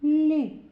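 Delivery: "Лі" with a palatalised L; loud, isolated soft "Лі". Shown as a demonstration. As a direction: model the soft L clearly in "Лі". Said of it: "Ми його вимовляємо м'яко".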